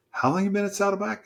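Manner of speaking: high-pitched, quick astonished question; incredulous. Retold or imitated. Pastor to newcomer who leads a group, incredulous. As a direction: ask again in retold disbelief, voice leaping up.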